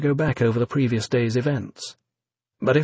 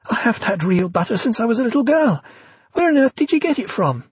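excited; 11 semitones higher than usual. A narrator's reading. Evie delighted by real butter.

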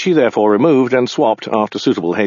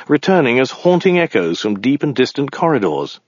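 ordinary delivery

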